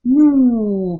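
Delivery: نو loud, with the oo stretched; نو drawn out loudly for the class; long oo demo. Shown as a demonstration. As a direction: hold out the oo of نو. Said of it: "أنا بطول وبمد في الحركة الطويلة".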